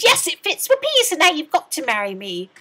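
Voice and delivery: very high, loud triumphant scream; the ugly sister screams back at the prince. A narrator's reading. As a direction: shriek it in triumph. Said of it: "But she screamed".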